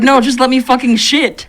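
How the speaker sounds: mock-irritated outburst at an interruption; annoyed protest. Pissed person on the toilet to the one talking, exasperated protest.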